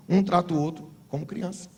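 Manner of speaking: normal talking voice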